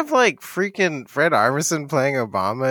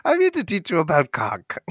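Puss in Boots. Retold or imitated. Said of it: "Puss in Boots".

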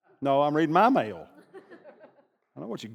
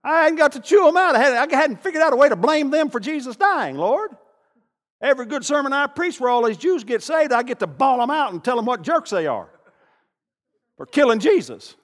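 high, loud whining Peter to the Lord; hammed-up complaining Peter; imagined Peter's complaint; 10 semitones higher, 7 dB louder than usual. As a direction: whine to God like a cheated preacher. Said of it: "you know he was thinking it".

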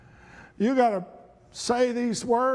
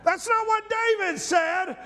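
full-force preaching; 7 semitones higher than usual. Acted. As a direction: shout the prayer with joy.

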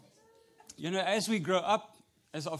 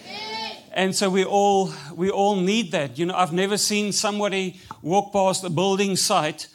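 louder, driving sermon delivery; preaching; 11 dB louder than usual. Acted. Pastor preaching to the church, louder full-force preaching. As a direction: preach it out to the congregation.

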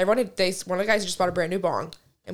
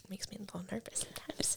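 whispering; 20 dB quieter than usual. Real, not acted. Confessing nervousness.

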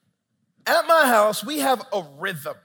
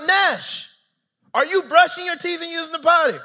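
loud, high-pitched dad holler; yelling at kid; 9 semitones higher, 9 dB louder than usual. Retold or imitated. Dad checking on his son upstairs, yelling. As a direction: shout it up the stairs, exasperated parent. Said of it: "I yell at him".

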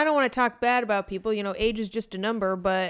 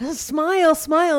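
pushy stage mom urging smile, higher and louder; 5 semitones higher, 6 dB louder, slower than usual. Retold or imitated. Mocking partner as a pushy stage mom, hammed-up. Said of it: "like, a stage mom".

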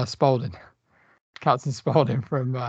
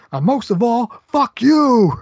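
hammed-up villain shout, much higher and louder; Captain Spaulding's final insult; 9 semitones higher, 7 dB louder, slower than usual. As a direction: builds to a gleeful mock yell on the last words. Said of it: "leans over him and he goes".

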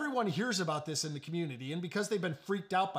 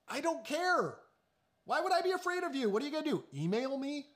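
high, fast, scoffing dare; quick mocking taunt, pitched up; mock defiance; 6 semitones higher than usual. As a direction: toss it off like a cocky challenge. Preacher voices his defiant inner thought.

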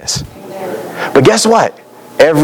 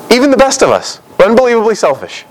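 emphatic burst; 9 semitones higher, faster than usual. Real, not acted. Preacher's emphatic outburst.

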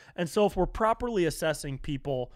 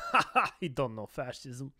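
mock laughing fascist; 3 semitones lower, 5 dB quieter than usual. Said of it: "looking at us like".

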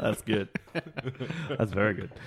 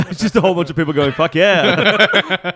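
laughing; 5 semitones higher, 9 dB louder than usual. Retold or imitated. Mocking fans shouting.

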